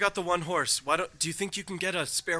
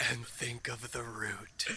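slow, hushed whisper; 6 semitones lower, 12 dB quieter, slower than usual. Acted. Joining in the secret message to Darian, whisper. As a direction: breathe it secretly into an ear. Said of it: "very quietly whisper into Darian's ear".

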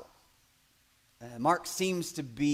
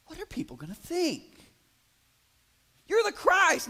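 rising alarm. Retold or imitated. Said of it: "You imagine going".

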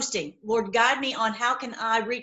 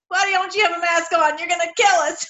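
mock-alarmed shoppers, pitched higher and louder; scolding shoppers; 7 semitones higher, 5 dB louder than usual. Masked shoppers scolding her at the store, mock-alarmed and higher. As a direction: play the masked crowd accusing her, higher. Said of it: "they're looking at me like".

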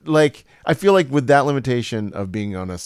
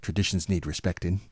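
softer British character voice; British accent; 3 semitones lower, 7 dB quieter than usual. Shown as a demonstration. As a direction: finish softly in a British accent. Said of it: "the other one was like".

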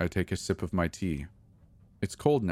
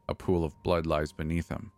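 plain reading voice

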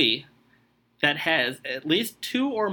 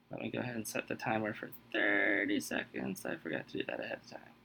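much softer, whispered to self; whispered aside; 5 semitones lower, 13 dB quieter, faster than usual. Real, not acted. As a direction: mutter it under your breath. Muttered aside while setting timer.

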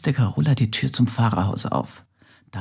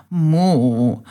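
louder, higher embarrassed moo; sheepish moo; 5 semitones higher, 6 dB louder than usual. Acted. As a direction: moo like a cow caught out. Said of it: "scharrte verlegen". (verlegenes Muhen, etwas lauter und höher)

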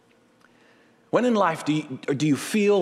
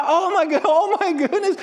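much higher, laughing joy; ecstatic squeal; 12 semitones higher, 4 dB louder than usual. Retold or imitated. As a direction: squeal with delight. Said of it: "You're just gonna be jumping up and down".

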